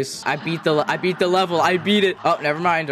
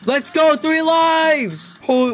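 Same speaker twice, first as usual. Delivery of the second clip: high, thrilled shout of relief; real excitement, much higher; excited cheer; 11 semitones higher, 3 dB louder, slower than usual. Real, not acted. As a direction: cheer the comeback. Surviving with lives left.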